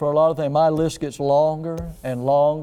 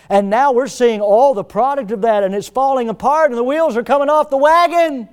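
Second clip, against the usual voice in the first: high, loud full-force preaching; 8 semitones higher, 8 dB louder than usual. Acted. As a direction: build to a fiery climax.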